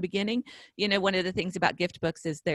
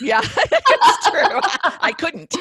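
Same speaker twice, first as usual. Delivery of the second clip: laughing through the words, slow and loud; can't stop laughing while agreeing; 8 semitones higher, 9 dB louder, much slower than usual. Real, not acted. Laughing at shared joke.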